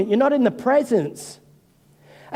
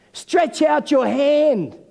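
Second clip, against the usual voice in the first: slow, higher-pitched, drawn-out command; retold command; 5 semitones higher, much slower than usual. Jesus to the man with a shriveled hand, slow command. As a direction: stretch each word out as an order.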